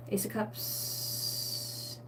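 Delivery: very slow, breathy reveal of the card; hushed whisper. Real, not acted. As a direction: whisper the card name slowly as if it's a secret. Reading out a tarot card.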